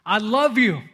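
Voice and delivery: screamed message. Pretend. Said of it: "hear it screaming a message to you".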